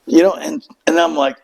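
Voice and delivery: ordinary delivery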